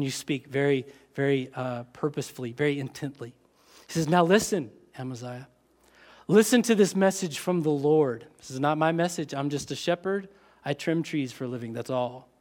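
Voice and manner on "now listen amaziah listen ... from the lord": usual speaking voice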